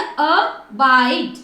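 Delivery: अबाइड split slowly into a short "a" and long "baaid"; sounded-out word. Shown as a demonstration. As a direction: draw out अबाइड syllable by syllable. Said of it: "तो क्या बन गया". (अबाइड को तोड़कर, धीरे बोलें)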